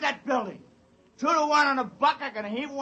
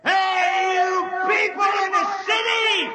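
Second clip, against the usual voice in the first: calling out; 4 semitones higher, slower than usual. Acted. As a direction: holler to the empty city. Biggs calling out into city.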